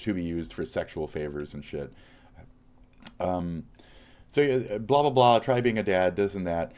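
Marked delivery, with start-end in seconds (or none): 4.3-6.7 loud, high, scornful blah blah blah; mock their advice with real fury; raised, heated scoff; angry mocking rant. Real, not acted. Ranting at online critic.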